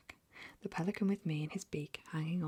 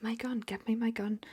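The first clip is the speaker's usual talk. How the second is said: higher, urgent demand; whispered shout; 6 semitones higher, 4 dB louder than usual. Acted. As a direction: demand the gun in a hushed yell. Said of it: "the Duke was shouting".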